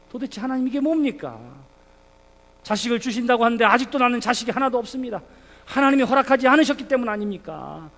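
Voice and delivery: high, loud protest to God; acted exasperated outcry; lamenting complaint. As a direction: cry out Abraham's grievance in prayer. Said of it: "그것이 또 하나의 탄식하는 기도입니다".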